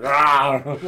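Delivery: loud growling roar, full voice; animal roar as Vince. Retold or imitated. Mock Vince roaring at the wrestlers. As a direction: roar like a beast smelling blood. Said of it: "I can so picture Vince like".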